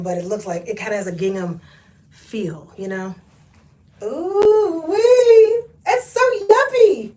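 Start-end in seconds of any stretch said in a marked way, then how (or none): 4.0-7.1 high squealing delight, drawn out; excited squeal. Real, not acted. Thrifting vlogger squealing at a preppy shirt, delighted. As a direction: squeal with delight over a cute find.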